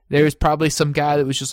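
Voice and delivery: normal talking voice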